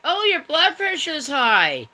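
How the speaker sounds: high sing-song mock doctor. Retelling the doctor visit to viewers, high mock voice. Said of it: "Then he's like".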